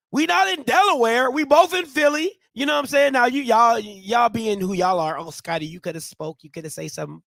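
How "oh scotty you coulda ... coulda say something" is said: quiet grumble. Acted. Muttered complaint at silent fellow alumni.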